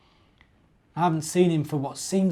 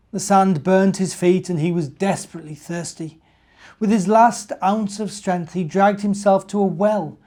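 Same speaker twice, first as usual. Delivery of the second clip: put-on lively, louder read-aloud voice for children; animated storyteller reading to kids; story narration; 4 semitones higher, 7 dB louder than usual. A narrator's reading. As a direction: read it like a bedtime story to little ones. Reads the Advent story aloud.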